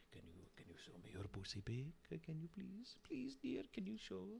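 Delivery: very soft, whispery pleading; begging creep. Acted. Creep begging a stranger for a picture, whispered.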